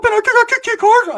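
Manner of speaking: put-on high kid voice. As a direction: whine like a boastful little boy. Voicing Ricky insisting.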